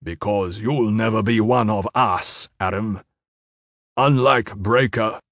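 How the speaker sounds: measured, deliberate menace; low and dangerous. A narrator's reading. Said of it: "low and dangerous".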